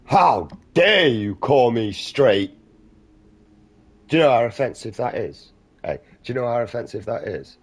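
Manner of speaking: hammed-up outrage at being called straight; mock offended person. Parodying someone offended at being called straight, loud hammed-up outrage.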